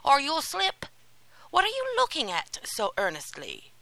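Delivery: high, loud warning call. A narrator's reading. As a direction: warn him loudly from behind. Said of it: "he said".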